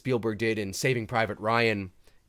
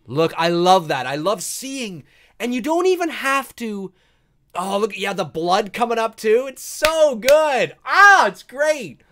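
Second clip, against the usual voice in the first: louder and higher, the last words drawn out slowly; gushing excitement; 9 semitones higher, 10 dB louder, slower than usual. Real, not acted. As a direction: rave about the shot you love, savouring it. Gushing while watching favourite scene.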